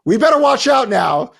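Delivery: high, loud mock panic; mock alarm. Host mock-warns listeners Cuba is coming, in hammed-up alarm. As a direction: sound the fake alarm, hammed up.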